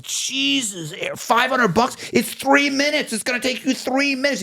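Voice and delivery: very high-pitched, squeaky mock outrage; incredulous outrage. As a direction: squeal it in disbelief at the price. Retelling outrage at the price.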